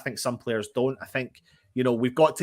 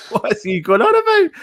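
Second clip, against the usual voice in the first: high, loud outburst through laughter; incredulous laughter; 11 semitones higher, 9 dB louder than usual. Real, not acted. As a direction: crack up while asking, voice shooting up. Cracking up at chat message.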